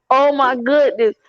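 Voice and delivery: ordinary talk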